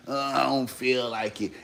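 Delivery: a mumbled, unwilling morning complaint; groggy reluctant grumble. Retold or imitated. Mocking someone who won't get up.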